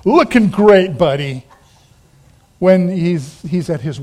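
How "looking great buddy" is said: teasing mock praise, dropping at the end; sarcastic. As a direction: needle him with fake admiration. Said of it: "has a bit of sarcasm here".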